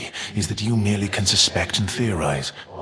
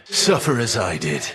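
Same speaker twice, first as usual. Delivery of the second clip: normal talking voice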